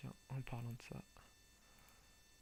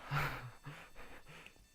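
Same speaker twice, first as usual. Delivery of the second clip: bursting laugh; 3 semitones higher than usual. Real, not acted. Laugh at crash.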